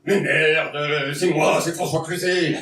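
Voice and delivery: exasperated raspy-edged Cluzet outburst; a stand-up doing a loud, fed-up Cluzet; François Cluzet impression. Comedian on stage, impersonating François Cluzet, exasperated. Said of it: "je me sens obligé de dire le nom de la personne que j'imite".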